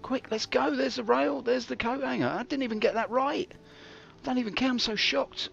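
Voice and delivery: high, eager, hurried; excited rush. Acted. Streamer rushing through the station, excited and high. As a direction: bubble over with excitement.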